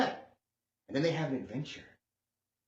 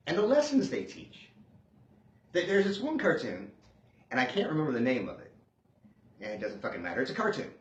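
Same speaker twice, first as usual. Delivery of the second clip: usual speaking voice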